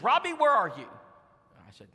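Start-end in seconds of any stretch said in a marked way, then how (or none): 0.0-0.9 imitating sister, higher and louder calling his name; a worried sister calling out; urgent sister. Sister calls urgently on phone.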